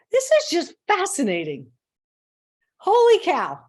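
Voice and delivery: loud, high-starting amazed declaration; exaggerated fascination. Coach modelling a swap for frustration, exaggerated fascination. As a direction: marvel in place of fuming. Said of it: "instead of being".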